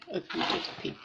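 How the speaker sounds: ordinary delivery, nothing marked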